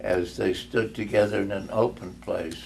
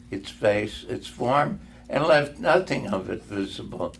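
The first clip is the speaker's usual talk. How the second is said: ordinary delivery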